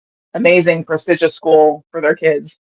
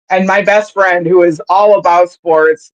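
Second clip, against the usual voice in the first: usual speaking voice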